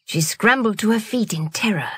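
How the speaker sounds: ordinary delivery, nothing marked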